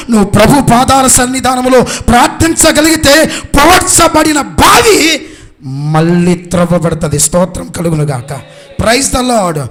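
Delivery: loud, high preacher's shout; impassioned preaching. Real, not acted. Preacher telling of the rowdy father, impassioned shouting. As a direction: proclaim it to the whole congregation at full force.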